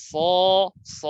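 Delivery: স as a thick, rounded "so"; heavy sound. Shown as a demonstration. Teacher showing learners a heavy letter, thick স. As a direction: make স as full-mouthed as possible. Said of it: "মোটা থাকবে উচ্চারণ এরকম".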